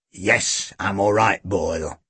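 low, subdued reassurance; weary police chief. A narrator's reading. Chief answering his worried sidekick, wearily subdued. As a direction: settle the sidekick, voice low and sinking.

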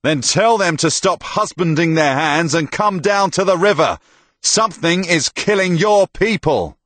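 high, loud, urgent challenge; angry shouting. A narrator's reading. Tomas demands help from the herald, shouting angrily. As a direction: shout them down to the river.

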